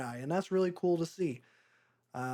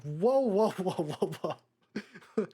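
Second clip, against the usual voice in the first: high, louder, laughing whoas, stretched out; mock alarmed whoa; 4 semitones higher, 3 dB louder, much slower than usual. Reviewer balking at the movie's romance, mock alarm. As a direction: pull back in mock disbelief, half laughing. Reviewer's own reaction to scene.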